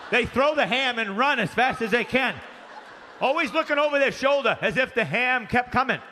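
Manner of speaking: ordinary talk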